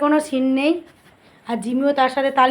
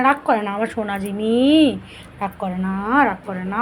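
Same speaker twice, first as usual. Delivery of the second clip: soft soothing; 5 semitones lower, 4 dB quieter than usual. Real, not acted. Soothing dog softly.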